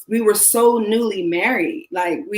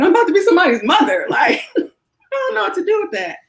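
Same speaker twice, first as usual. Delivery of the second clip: nervous laughing panic; 11 semitones higher than usual. Real, not acted. Panicking over becoming a mother.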